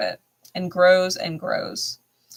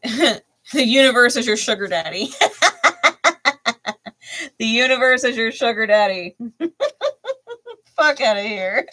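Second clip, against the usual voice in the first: laughing; 5 semitones higher, 5 dB louder than usual. Real, not acted. Laughing at the card's wording.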